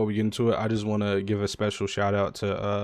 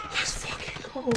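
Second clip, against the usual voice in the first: whispered cheer; 8 dB quieter than usual. Real, not acted. Celebrating win, unvoiced.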